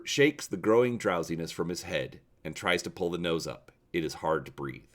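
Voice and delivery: plain reading voice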